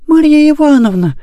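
put-on very high, falling girl's cry; disappointed exclamation. A narrator's reading. Girl finding nanny at the door, disappointed high exclamation. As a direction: exclaim as a let-down little girl. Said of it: "воскликнула Мурка разочарованно".

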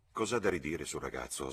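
plain reading voice

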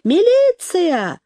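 high, drawn-out cry for help; slow, very high-pitched hollering; frantic wail. A narrator's reading. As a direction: wail for the police, stretching the vowels. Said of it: "Тётка завопила истошно".

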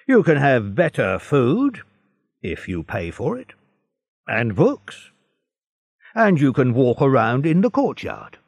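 guard offering terms, matter-of-fact; guard telling the prisoner his options, lightly voiced. A narrator's reading.

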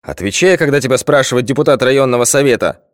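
mild mock bark of a headmaster; barked order. A narrator's reading. Headmaster barking at a silent pupil, understated. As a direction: snap the order at the boy, lightly. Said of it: "гаркнул директор".

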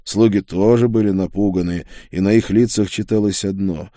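usual speaking voice

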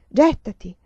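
loud, urgent command; urgent shout. A narrator's reading. Emanuela calling to falling Stefano, a loud urgent shout. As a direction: shout it out to him in the sky.

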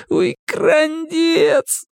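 high, drawn-out whimper; whining wail. A narrator's reading. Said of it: "заскулил киса". (скулящий, протяжный стон)

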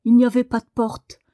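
loud, quick protest; fast exclamation; crying out. A narrator's reading. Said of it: "cria Daniel".

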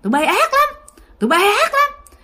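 high-pitched angry accusation; scolding curse. Acted. Possessed grandfather cursing at grandma, scolding. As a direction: rail at them in a high voice. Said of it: "chỉ vô mặt bà ngoại chửi".

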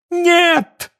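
high cry that breaks; voice cracking. A narrator's reading. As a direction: scream it so the voice cracks. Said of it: "сорвавшимся голосом крикнула". (срывающийся голос)

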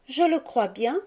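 put-on mildly assured child, voice lifted; calm certainty. A narrator's reading. Violette answering her brother, with calm certainty. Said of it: "avec le calme de la certitude".

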